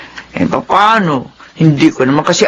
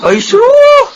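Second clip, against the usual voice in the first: flustered scoff; 5 dB louder than usual. Acted. Mother brushes off flattery.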